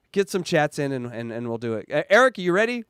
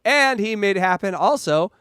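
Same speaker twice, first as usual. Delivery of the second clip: usual speaking voice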